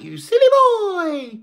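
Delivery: high, loud squeal on silly; playful squeal. Acted. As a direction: tease the cat in a high squeal. Teasing the cat again.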